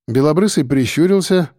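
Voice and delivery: usual speaking voice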